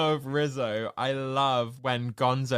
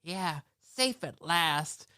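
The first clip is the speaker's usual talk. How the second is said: Rizzo impression; 3 semitones higher, 3 dB quieter, slower than usual. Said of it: "And Rizzo goes".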